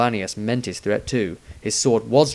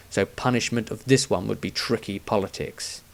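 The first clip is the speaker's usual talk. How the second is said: usual speaking voice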